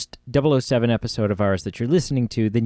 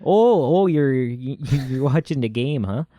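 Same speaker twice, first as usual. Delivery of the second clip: Canadian accent. Shown as a demonstration. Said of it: "Canadian accent".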